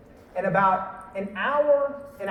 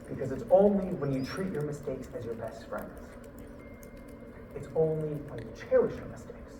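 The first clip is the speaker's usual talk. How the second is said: quiet wise advice; 7 semitones lower, 12 dB quieter than usual. Retold or imitated. Mentor gives quiet life advice.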